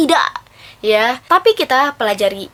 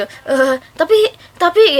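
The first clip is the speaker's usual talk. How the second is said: nervous stammer; 4 semitones higher, slower than usual. Retold or imitated. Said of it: "nervous gitu".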